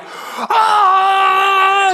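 high, loud joyful yelp; players' gleeful shout. Retold or imitated. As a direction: burst out like a kid who got ice cream. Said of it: "ripped dudes are like".

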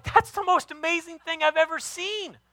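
high, gushing mock amazement; over-the-top praise. Dad praising his son's leaf pile, high gushing amazement. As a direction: gush over it like a proud dad. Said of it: "I'm like".

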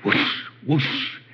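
breathy whooshing noise; airy whoosh effect; whoosh sound. Retold or imitated. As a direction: blow it out like wind rushing past. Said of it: "And then he'd go".

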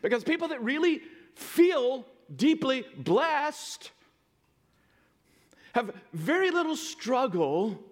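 high, slow preaching with stretched key words; emphatic preaching. Real, not acted. Preacher presses home his point.

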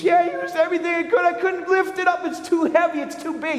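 put-on high, fast, earnest little boy insisting; a small kid protesting it's too heavy. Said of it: "And the little boy says".

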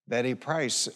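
ordinary talk

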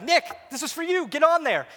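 high, loud retold summons; urgent calling out. Pastor calls him to the rod.

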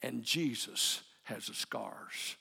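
drawn-out, word by word, for emphasis; slow it right down and land each word; emphatic slow preaching. Acted. Preacher closing the sermon on God's faithfulness, very slow and emphatic.

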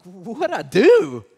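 slow, loud, startled question; baffled, caught off guard; bewildered. Retold or imitated. Boy suddenly blamed by angry classmate: startled loud question. Confused kid suddenly targeted.

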